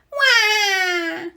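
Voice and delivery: very high cartoon crying wah; toddlers wailing. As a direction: bawl like two small children. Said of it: "they cry".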